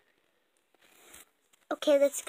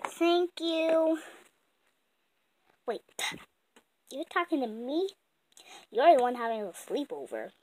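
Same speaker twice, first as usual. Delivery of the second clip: ordinary talk, nothing marked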